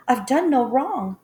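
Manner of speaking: high, loud frightened protest; desperate cry. A narrator's reading. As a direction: plead innocence with all you have. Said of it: "She cried again".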